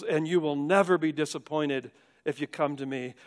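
normal talking voice

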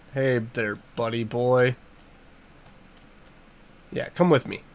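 softly luring a cow home; gentle coaxing. Real, not acted. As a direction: coax the cow along quietly.

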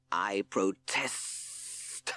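slow hissing snake voice; hissed. Acted. Hissing Sid protests to the court in a slow snake hiss. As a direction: stretch the s sounds like a snake. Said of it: "hissed Hissing Sid".

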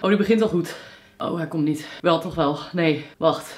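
about to sneeze; fighting off a sneeze; holding back a sneeze. Real, not acted. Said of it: "Ik moet niezen".

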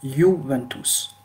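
slow "Juventus", drawn out as yoo-ven-toos; "Juventus" with a long Italian yoo; Italian pronunciation. Shown as a demonstration. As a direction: model "Juventus" slowly, J as a long i. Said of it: "en italien, on dit Juventus".

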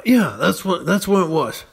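normal talking voice

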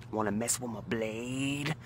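slow, soft, taunting threat; lightly voiced street kid toying with a knife; menacing teen thug. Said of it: "I remember the exact inflection in his voice".

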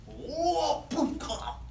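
high whoosh into a gulp; imitated fish gulp. Storyteller acts out the fish swallowing Jonah, exaggerated sound effects. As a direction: make big cartoonish swallowing noises.

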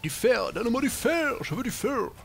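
high, loud playful demand; mock demanding shout. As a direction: cry out greedily for it, high-pitched. Mock demand for iron in game.

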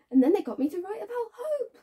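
high, loud, anguished sarcasm; bitter outburst. Acted. Woman recalling forced letters about hope, bitterly worked up. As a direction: push out the words with rising bitterness, stretching some.

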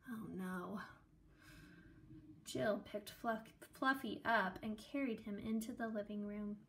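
ordinary delivery

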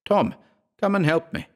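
high, pleading call for help; desperate plea. Acted. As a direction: beg for help in panic. Trapped narrator begs for help.

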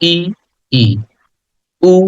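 ই, ই and উ each said as a single drawn-out vowel sound; teacher modelling vowel sounds. Shown as a demonstration. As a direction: model the vowels ই and উ slowly, one at a time. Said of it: "sounds গুলো একবার করে উচ্চারণও করে দিব".

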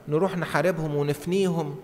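usual speaking voice

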